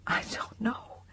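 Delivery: barely voiced, lost whisper; stunned whisper. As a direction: breathe the words out. Stunned answer.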